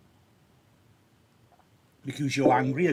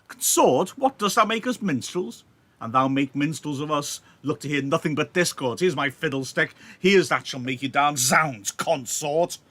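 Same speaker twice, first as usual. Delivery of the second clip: raised voice, spoiling for a fight; angry Mercutio; 2 semitones higher, 5 dB louder than usual. Acted. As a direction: fling the insult back at him. Mercutio provoked, reading angrily.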